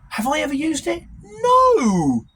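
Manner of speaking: loud, high, fed-up outburst; exasperated shout. Real, not acted. Ranting about useless school maths, shouting. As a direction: throw the question out and slam the no.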